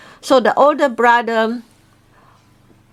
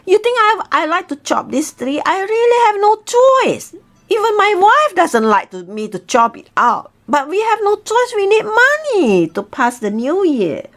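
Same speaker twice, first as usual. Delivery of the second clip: poor man pleading; 7 semitones higher than usual. Acted. Poor man answers eagle.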